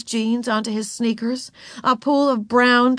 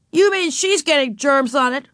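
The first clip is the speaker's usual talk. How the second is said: high, raised indignant question; indignant alarm; 7 semitones higher, 3 dB louder than usual. A narrator's reading. Wayne hears of germs on his sucker, indignant. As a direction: question it in dismay.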